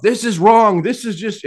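high-pitched mock whining protest; nonstop complaining. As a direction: imitate a buddy who won't shut up. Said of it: "he's just complaining".

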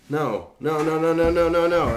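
loud, high, rapid-fire refusal; acting out a frantic freak-out; panicked shouting. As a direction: yell in rising alarm. Retold panic at ant city.

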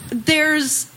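ordinary talk

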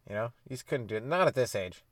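ordinary talk

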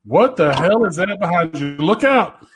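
high loud warning yell; alarm shout. Pretend. Sudden fake alarm on camera.

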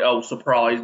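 ordinary talk, nothing marked

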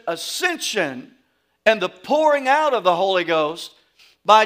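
preacher building to a peak before the congregation; preaching at full force. Acted. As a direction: drive it home like a revival preacher.

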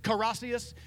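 "caracias" with an extra syllable, said brightly and a bit high; child's mispronunciation. Retold or imitated. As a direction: say "caracias" like a kid mangling gracias. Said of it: "she pronounced it".

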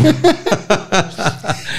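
big high burst of laughter; loud laugh. Real, not acted. Comedian laughing at his own marriage story, loud laugh. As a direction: let out a hearty real laugh.

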